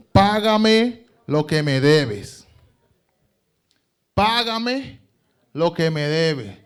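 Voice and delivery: loud, slow harsh demand; a creditor shouting for his money; demanding payment. Acted. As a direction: choke the debt out of him. Servant choking debtor, demanding payment.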